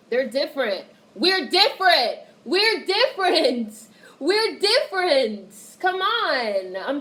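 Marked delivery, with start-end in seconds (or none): 1.1-5.5 louder and higher, hammering each word; slow and punchy, pound it home; emphatic repeat. Real, not acted. Streamer insisting to chat, louder and higher.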